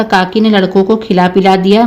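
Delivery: ordinary delivery, nothing marked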